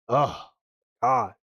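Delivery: ordinary talk, nothing marked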